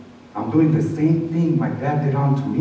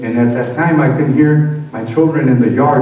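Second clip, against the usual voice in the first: usual speaking voice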